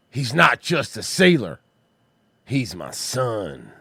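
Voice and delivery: slow, low, dramatic proud father; lightly voiced proud dad, drawn out. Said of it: "Read it in the voice".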